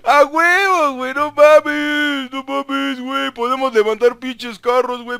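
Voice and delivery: hyped bro shouting, high and loud; frat bro. Real, not acted. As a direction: yell it like a pumped-up bro.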